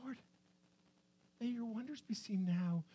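barely audible whisper; whispered prayer. Acted. Psalmist addresses the Lord in prayer, whispered. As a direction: whisper it up to God.

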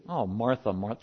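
soft, low sigh of a reproof; gentle rebuke. Shown as a demonstration. Jesus correcting Martha without yelling, gentle. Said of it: "He's being gentle about it".

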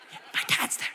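whispered congregant aside; mock whisper. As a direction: whisper it as if a parent could hear. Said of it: "a lot of people are like".

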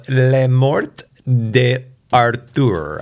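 French accent, earnestly attempted. Shown as a demonstration. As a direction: read the title in your best amateur French. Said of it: "that's my best French accent".

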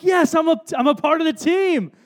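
high, delighted yes; thrilled to be included; eager reply. Retold or imitated. Boy answering his bandmates, high and eager.

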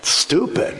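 scoffed under the breath; mock the idea with a breathy scoff; a breathy, dismissive "Stupid.". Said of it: "you're like".

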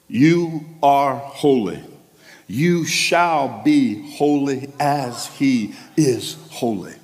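You are holy, drawn out very slowly, each word weighed; slow emphatic declaration. Shown as a demonstration. Preacher declaring holiness to himself, slow and solemn. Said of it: "say that a few times".

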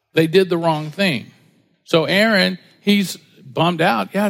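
ordinary delivery

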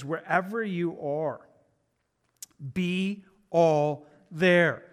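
very slow, each word set apart; deliberate quotation. Retold or imitated. Pastor sharing a favorite quote with the church, slow and deliberate. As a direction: space out the words for weight, pausing midway.

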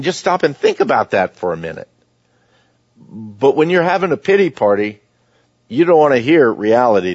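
usual speaking voice

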